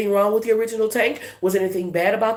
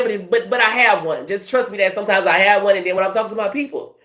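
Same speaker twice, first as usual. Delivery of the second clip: quick, running Baton Rouge talk; Baton Rouge accent; 3 semitones higher, faster than usual. Shown as a demonstration. Reader demonstrates talking to her people, quick Baton Rouge accent. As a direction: let the Baton Rouge accent run fast, words tumbling together. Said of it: "if I just talk like how I regular talk".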